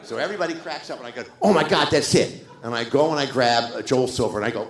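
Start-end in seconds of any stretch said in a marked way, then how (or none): 1.4-2.5 loud, high burst of excitement; retold eureka moment. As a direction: re-enact a sudden brainwave. Retold sudden moment of realization.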